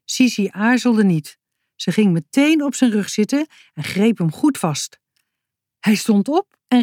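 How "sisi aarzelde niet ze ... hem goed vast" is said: plain reading voice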